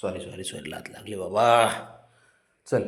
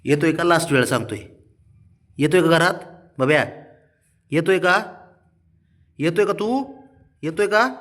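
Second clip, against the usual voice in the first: rising higher with each repeat; insistent asking; 7 semitones higher, 5 dB louder than usual. Real, not acted. Owner gives the dog a last warning, rising insistent question. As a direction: press the question harder and higher each time.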